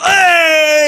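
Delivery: high, drawn-out outraged shout; grandpa yelling. Retold or imitated. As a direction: imitate an angry grandpa exploding. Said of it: "He starts yelling".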